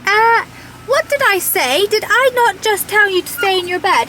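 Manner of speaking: high, loud mock telling-off; mock scolding dog. Telling off her naughty dog, mock scolding. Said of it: "I'll be like".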